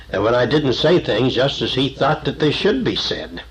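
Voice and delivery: ordinary delivery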